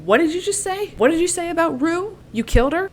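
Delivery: high, strained disbelieving fury; furious demand. Acted. Thresh confronting Clove about Rue, high furious disbelief. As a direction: demand it, voice cracking up high with rage.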